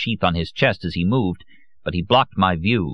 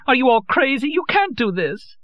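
much higher desperate plea; tearful exclamation. A narrator's reading. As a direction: cry out in tears to stop them. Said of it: "In tears, she exclaimed".

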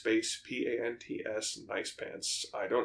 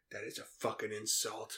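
James Gandolfini; 2 semitones lower, 10 dB quieter than usual. Acted. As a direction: breathe heavily through a quiet, offended line. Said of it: "James Gandolfini is a heavy breather".

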